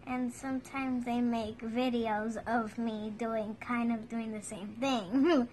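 talking under a face mask; finger touching upper lip. Real, not acted. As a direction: lips pressed by finger. Seen 0: Another person's finger rubs cream at her nose and upper lip.

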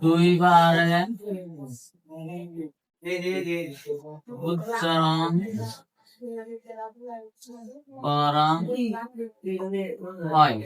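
word by word, with long gaps for writing; dictation. Real, not acted.

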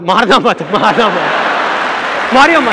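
hammed-up cry to stop the fight; bystander shouting. A bystander eggs on two men at a red light, shouting. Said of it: "कहता है".

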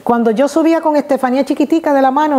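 usual speaking voice

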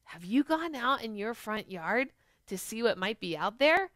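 ordinary delivery

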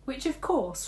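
ordinary delivery, nothing marked

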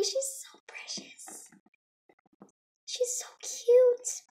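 high, slow, hushed gushing; adoring whisper. Real, not acted. Vlogger gushing over the doll, adoring whisper. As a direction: whisper it, melting with adoration.

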